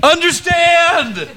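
long, drawn-out high-pitched yell; hammed-up teacher's yell. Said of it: "your teacher yelling back at you".

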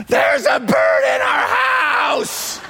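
high, slow frantic yell; panicked shout. Retold or imitated. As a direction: scream it in panic about the bird. Wife calls about bird.

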